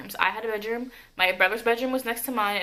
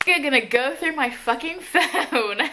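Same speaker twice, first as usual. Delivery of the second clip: higher, drawn out, breaking into laughter; amused disbelief; 6 semitones higher, 3 dB louder, slower than usual. Real, not acted. Storyteller mocking the ex who went through the phone, laughing disbelief. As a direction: laugh through the last words. Laughs while recalling the snooping.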